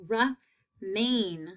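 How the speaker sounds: remain in two separate beats; split into syllables. Shown as a demonstration. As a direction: pause between re and main in remain. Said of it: "long A in that second syllable".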